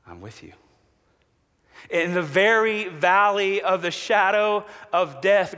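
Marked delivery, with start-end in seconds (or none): 0.1-0.5 much softer and lower; softly voicing God, reassuring; hushed promise. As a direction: murmur God's promise gently. God's promise quoted softly.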